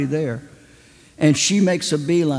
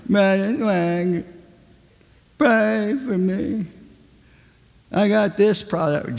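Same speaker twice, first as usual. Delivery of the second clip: higher, slow pleading whine; whining pianist; 4 semitones higher, slower than usual. Retold or imitated. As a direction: beg the preacher, drawn out.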